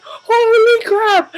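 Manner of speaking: high, loud, stretched-out holy crap; horrified exclaim. Retold or imitated. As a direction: gasp it out in terror. Reacts to scary twist.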